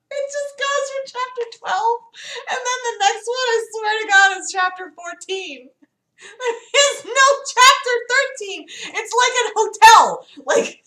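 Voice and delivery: very high, squeaky disbelief; incredulous. Real, not acted. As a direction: insist on it in astonished high pitch. Reader incredulous about the skipped chapter.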